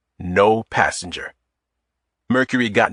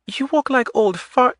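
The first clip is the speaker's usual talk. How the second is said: light Eastern European accent, voiced high; a woman teasing with a light Eastern European lilt; 14 semitones higher than usual. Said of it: "In a light Eastern European accent".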